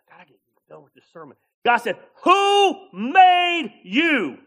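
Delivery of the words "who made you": very slow, higher, louder divine demand; booming question. Retold or imitated. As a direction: stretch it out like God thundering.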